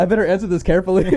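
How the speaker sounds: fast, high, giggling; laughing mock thought. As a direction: imitate the waiter's nervous thought through laughter. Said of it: "voicing the waiter".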